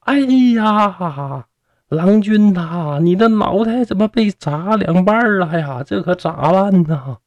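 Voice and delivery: high, drawn-out wail of dismay; crying out in alarm. A narrator's reading. Woman calls to her injured lover, high wailing cry. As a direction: wail it like a storyteller's distraught woman. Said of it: "听里边啊大喊道".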